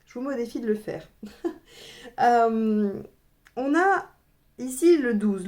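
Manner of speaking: ordinary talk, nothing marked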